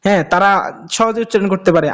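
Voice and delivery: ordinary talk, nothing marked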